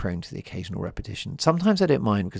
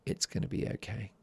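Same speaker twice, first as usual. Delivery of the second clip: soft, breathy reassurance to a partner; gently, almost under the breath; 8 dB quieter than usual. Retold or imitated. The guy soothing his upset girlfriend, softly. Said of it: "She wanted the guy to say".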